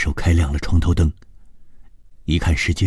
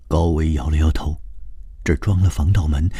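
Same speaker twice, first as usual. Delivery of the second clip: plain reading voice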